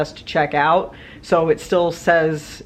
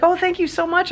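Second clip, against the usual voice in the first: much higher, eager thankful voice; grateful gentleman; 12 semitones higher than usual. Retold or imitated. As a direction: voice him high and delighted. Quoting the gentleman again.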